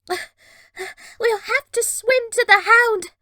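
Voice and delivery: spluttering with water in the mouth. Acted. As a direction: splutter it, mouth full of seawater. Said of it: "Red splutters".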